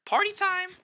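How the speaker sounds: imitating her own thrilled shriek; mock excited squeal. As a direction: squeal it like the night just got fun. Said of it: "I was like".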